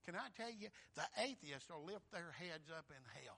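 ordinary talk

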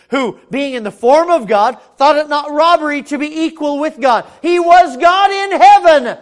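high shouted proclamation; full-force preaching. Acted. Preacher on Christ equal with God, full-force preaching. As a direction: build to a pulpit roar.

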